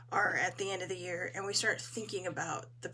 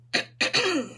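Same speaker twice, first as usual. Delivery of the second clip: throat clearing; 10 semitones higher, 8 dB louder than usual. Real, not acted. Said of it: "I do not know what is wrong with my voice".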